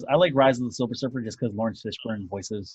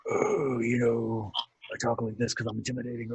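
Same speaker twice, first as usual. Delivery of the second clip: mock gravelly Batman growl; 5 dB quieter than usual. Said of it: "I just hate the growling voice".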